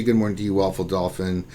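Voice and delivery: ordinary talk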